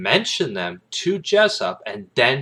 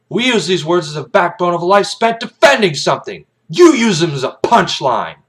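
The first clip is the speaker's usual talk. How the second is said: furious colonel speech; 9 semitones higher, 10 dB louder than usual. Acted. Colonel Jessup berates Kaffee in court, loud and furious. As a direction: lecture him from the stand with contempt.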